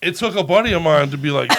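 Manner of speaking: ordinary delivery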